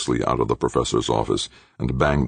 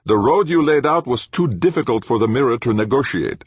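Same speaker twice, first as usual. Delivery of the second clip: high-pitched, louder put-on voice for an angry professor; stern professor, accusing; 10 semitones higher, 5 dB louder than usual. A narrator's reading. Professor blaming his engineer for the road, cold and accusing. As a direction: play the cold professor laying blame.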